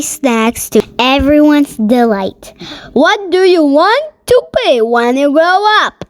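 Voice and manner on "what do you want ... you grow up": high teacher question. Acted. Teacher asks class.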